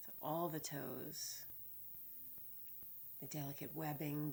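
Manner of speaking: unhurried body-scan guidance with long pauses; measured, spacious meditation voice; guided meditation. Real, not acted. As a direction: lead them calmly through the body, phrase by phrase.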